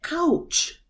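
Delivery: high pained ouch, falling; demo ouch. Shown as a demonstration. Teacher demonstrating a pained ouch to students. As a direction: yelp ouch like you got hurt. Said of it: "such as".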